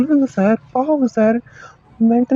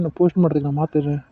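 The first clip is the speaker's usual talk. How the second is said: usual speaking voice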